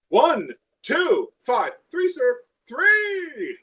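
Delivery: counting up high, then a big high shout on three; mock Holy Hand Grenade count. Player jokingly counts down a grenade throw, mock-solemn then shouting. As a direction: ham up the botched grenade count for the table.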